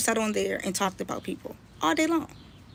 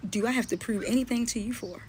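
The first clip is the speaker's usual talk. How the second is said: ordinary talk, nothing marked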